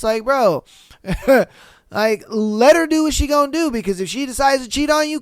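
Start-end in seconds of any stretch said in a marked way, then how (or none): none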